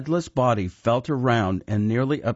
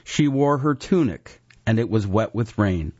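usual speaking voice

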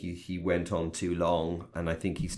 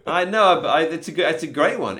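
excited; 8 semitones higher, 7 dB louder than usual. Real, not acted. Eager agreement.

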